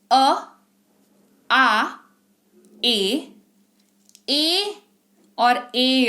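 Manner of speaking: अ, आ, ए each held alone; isolated vowel sounds. Shown as a demonstration. Teacher listing the sounds of A, each vowel held apart. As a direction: sound out अ, आ, ए separately, with pauses. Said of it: "आवाज निकालते हैं".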